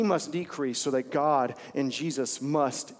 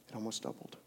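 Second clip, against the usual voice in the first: almost doubled dropped way softer; hushed repeat; 5 semitones lower, 14 dB quieter, faster than usual. Real, not acted. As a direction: let it sink in, much softer.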